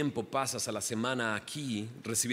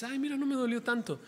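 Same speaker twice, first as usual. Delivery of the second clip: relieved surprise; 10 semitones higher, faster than usual. Pretend. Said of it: "y dices".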